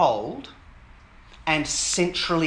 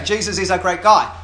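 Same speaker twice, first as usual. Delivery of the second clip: loud, distinct, clearly spoken message; 6 dB louder than usual. Acted. Jackson speaking openly to Anita, loud clear voice. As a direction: say it out loud so nobody could mishear. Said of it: "in a clear voice".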